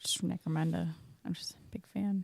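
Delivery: breathy joking whisper. Real, not acted. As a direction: whisper it like a confession.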